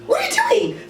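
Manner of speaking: a high, incredulous squeak at him; shocked. Retold or imitated. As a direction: voice jumps way up in disbelief. Shocked reaction to partner mid-sex.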